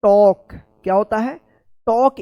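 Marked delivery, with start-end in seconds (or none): 0.0-0.5 टॉक said with a rounded aw and a silent l, like "tawk"; टॉक as "tawk", open rounded vowel, l dropped; correct pronunciation. Shown as a demonstration. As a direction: say टॉक the proper English way, no l sound. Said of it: "इसका सही उच्चारण होता है".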